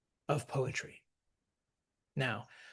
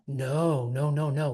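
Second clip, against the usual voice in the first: usual speaking voice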